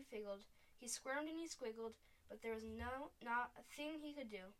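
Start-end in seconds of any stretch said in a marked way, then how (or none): none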